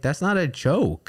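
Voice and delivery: sharp, high and slow retort; retold snap. Scolding partner over the joke, a high slow snap. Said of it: "So I, like, snapped at you".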